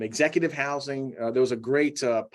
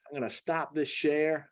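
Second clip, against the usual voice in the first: ordinary talk, nothing marked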